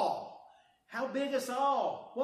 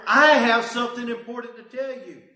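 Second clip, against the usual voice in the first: Jesus with all authority; 16 dB louder than usual. Acted. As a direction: lay each word down with authority. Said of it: "He says".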